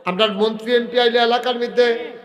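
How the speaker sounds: loud, high-pitched sermon delivery; full-force preaching. Acted.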